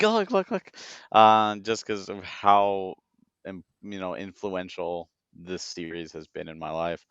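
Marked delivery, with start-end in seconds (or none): 0.0-0.7 high excited look-look; excited whisper-nudge. Retold or imitated. To his wife in the theater, high and excited. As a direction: point it out to your wife, thrilled.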